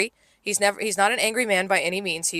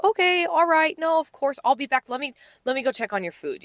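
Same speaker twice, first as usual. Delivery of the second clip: higher-pitched, placating customer-service voice; polite brush-off; 7 semitones higher than usual. Retold or imitated. Server excusing herself from a talkative customer, high placating politeness. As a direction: soothe the customer while edging away.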